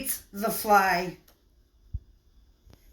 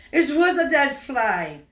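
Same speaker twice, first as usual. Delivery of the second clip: loud, higher shocked cry, then slowing; shocked outburst; 8 semitones higher, 10 dB louder than usual. Acted. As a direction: blurt out the horror in a Russian accent. Reveal of fly in roll.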